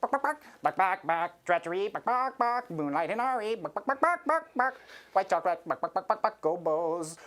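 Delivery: high clucking robot rattling off names; glitching robot chicken. Pretend. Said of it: "a robot doing chicken noises".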